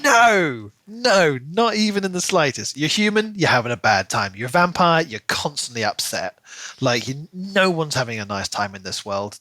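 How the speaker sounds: ordinary talk, nothing marked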